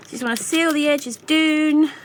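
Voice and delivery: put-on light playful Scottish accent. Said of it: "preferably with a Scottish accent".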